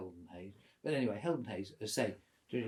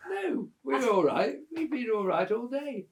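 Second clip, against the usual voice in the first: retold breezy reassurance; 11 semitones higher, 8 dB louder than usual. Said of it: "I said".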